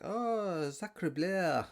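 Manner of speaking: high, exaggerated mock French exclamation; hammed-up French. Joking narrator mimics French girls' cliché exclamation. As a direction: ham up a cliché French tourist gasp. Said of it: "I've been dying to use that stupid line".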